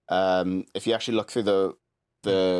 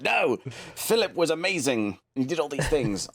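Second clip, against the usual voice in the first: higher-pitched mock protest; ultra loyalist; 5 semitones higher than usual. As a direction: imitate an indignant royal loyalist. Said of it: "you'll get the ultra loyalists that'll be like".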